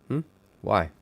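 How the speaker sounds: ordinary delivery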